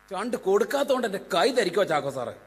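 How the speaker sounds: ordinary talk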